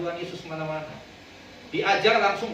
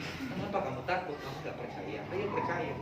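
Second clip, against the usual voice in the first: much softer, gentle coaxing; gentle correction; 3 semitones lower, 11 dB quieter than usual. Retold or imitated. Jesus gently correcting fearful disciples, much softer. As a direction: softly coax them back to faith. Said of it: "Tuhan Yesus justru memperbaiki".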